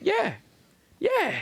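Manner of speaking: squeaky, very high "yeah, yeah"; emphatic yeah. Retold or imitated. Answering a friend about bumping [name], voice jumping very high. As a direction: shoot the voice way up in obvious agreement. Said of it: "I'm like".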